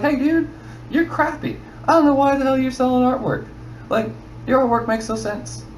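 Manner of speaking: mock a rude critic tearing into your art.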